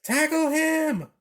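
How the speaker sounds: high, loud, slow scream. A narrator's reading. Someone urging the chasers on, high loud scream.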